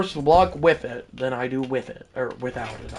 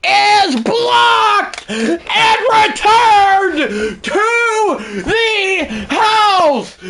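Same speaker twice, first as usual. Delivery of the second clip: high, slow, drawn-out shouted call; play-by-play announcer; 7 dB louder, much slower than usual. Pretend. Streamer calling a blocked kick returned, ecstatic announcer shout. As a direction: stretch every word in triumphant commentary.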